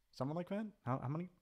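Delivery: usual speaking voice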